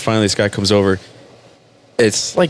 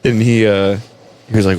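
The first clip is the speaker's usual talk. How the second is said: ordinary talk, nothing marked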